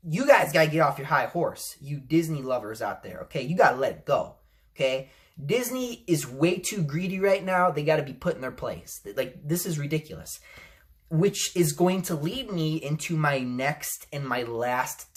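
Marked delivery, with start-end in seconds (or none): none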